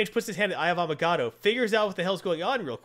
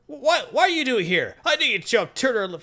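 villain gloating; 6 semitones higher, 4 dB louder than usual. Retold or imitated. Villain confronts hero.

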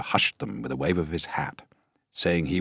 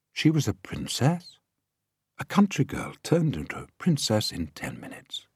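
plain reading voice

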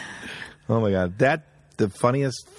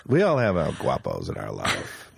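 lower-pitched mock speech; imitated pep talk, pitched down; movie hero speech; 10 semitones lower than usual. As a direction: deliver it as a solemn movie line. Quotes movie hero's speech.

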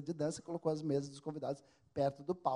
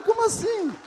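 much higher, louder "Como assim?" in shock; stunned disbelief; 9 dB louder than usual. Retold or imitated. Comedian learning it is not a wedding, shocked and high. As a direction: blurt it out as the floor drops away. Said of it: "Eu falei".

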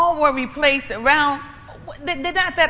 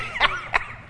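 high laugh. Real, not acted. Laughs after the warning.

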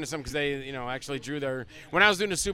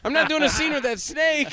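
high, loud mock protest; indignant refusal, retold; 11 semitones higher, 6 dB louder than usual. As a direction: refuse outright, voice pitched way up. Retold refusal of snake scene.

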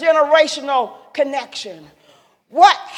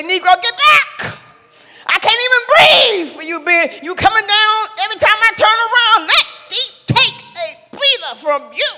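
higher, louder exasperated push-back; imagined snap back; 7 semitones higher, 4 dB louder than usual. Pretend. To someone hovering over her, an exasperated get back. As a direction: tell the hovering person to back off. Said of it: "What I should have done is say".

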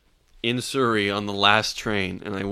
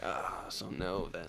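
deflated mumble; 6 semitones lower, 14 dB quieter, slower than usual. Retold or imitated. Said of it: "And I was like".

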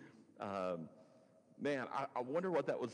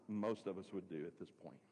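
ordinary talk

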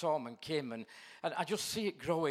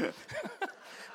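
high laugh; 4 dB quieter than usual. Real, not acted. Laughs at own joke.

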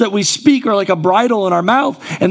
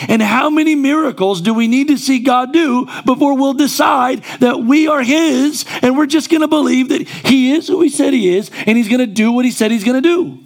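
a pastor urging the church to trust God; preaching at full force; 4 semitones higher than usual. Acted. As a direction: drive the challenge home to the congregation.

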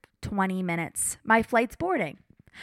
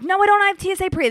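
higher, fast flustered no; flustered protest; 9 semitones higher, much faster than usual. Retold or imitated. Traveler hurriedly protests to security guard, flustered. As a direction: protest in a rush.

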